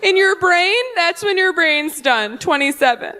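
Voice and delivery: high, lecturing, preachy; know-it-all. Retold or imitated. Audience woman lecturing the comic, high and condescending. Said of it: "She goes".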